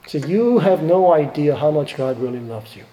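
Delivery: normal talking voice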